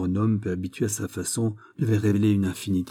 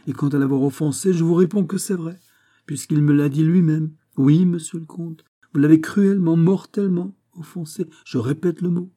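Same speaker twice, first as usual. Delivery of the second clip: higher-voiced, emphatic accuser; insistent Porthos; 8 semitones higher, 7 dB louder than usual. A narrator's reading. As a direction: press the accusation firmly, pitched up. (Porthos accusateur, voix haute et insistante)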